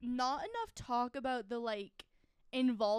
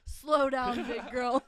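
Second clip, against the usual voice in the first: laughing soothing; 3 dB louder, slower than usual. Acted. Joking horse-calming.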